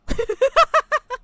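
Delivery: loud, high cackle of disbelief; big shrieking laugh; high burst of laughter. Real, not acted.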